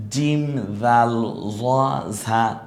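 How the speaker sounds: জিম, জাল, জ, ঝা drawn out very slowly; slow letter demo. Shown as a demonstration. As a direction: say it like the teacher, slowly. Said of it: "আমার মতো করে বলেন". (আমার মতো করে ধীরে বলুন)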